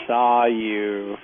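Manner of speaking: usual speaking voice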